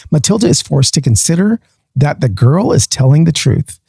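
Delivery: ordinary talk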